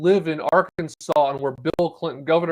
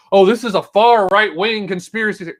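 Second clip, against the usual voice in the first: louder, higher mocking protest; mock objection; 4 semitones higher, 8 dB louder than usual. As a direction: parody an indignant sceptic dismissing the story. Mocks imagined objectors, then rebuts them.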